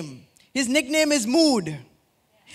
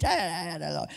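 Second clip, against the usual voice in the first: imitating little daughter; 6 semitones lower, 8 dB quieter, faster than usual. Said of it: "all that she can say is".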